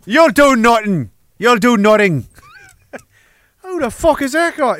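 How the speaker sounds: put-on Irish accent. Riffing as an Irishman for the livestream audience, Irish accent. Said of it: "that was Ireland".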